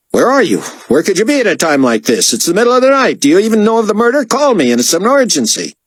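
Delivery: high, frantic diva rant; sassy artiste ranting. A narrator's reading. Artiste berating David by voicemail, ranting. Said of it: "nasty rantings of a sassy artiste".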